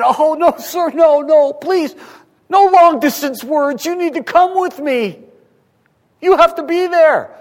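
high, loud, frantic begging; desperate father pleading. Retold or imitated. Father begs Jesus to come heal his son, frantic pleading. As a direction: beg Jesus to come with you, all panic. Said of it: "He, he could have said".

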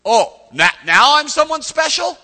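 loud, rising incredulity; mock indignant disbelief. Gideon answering God's praise, loud incredulity. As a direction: build to a high, loud challenge. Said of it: "He goes".

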